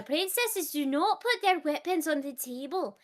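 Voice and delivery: high, prim Scottish scolding; Scottish queen mother. Retold or imitated. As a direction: lecture the princess in a mock-royal Scottish voice. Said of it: "I'm constantly sitting there being like".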